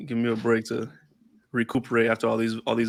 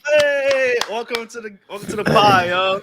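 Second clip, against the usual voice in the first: excited; 10 semitones higher, 5 dB louder, slower than usual. Real, not acted. Hyped welcome after mic fixed.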